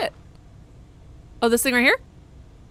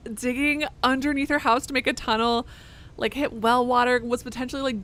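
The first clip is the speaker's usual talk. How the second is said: ordinary delivery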